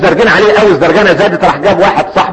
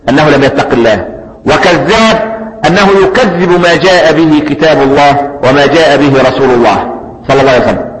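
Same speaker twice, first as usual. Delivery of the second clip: usual speaking voice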